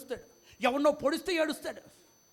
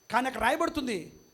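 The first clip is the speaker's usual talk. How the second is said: ordinary delivery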